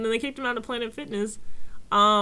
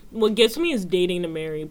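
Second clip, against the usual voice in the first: usual speaking voice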